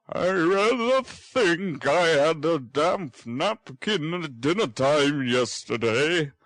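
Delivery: put-on gentle concern for the boy; kindly old gentleman. Brownlow asks the sick boy how he feels, kindly.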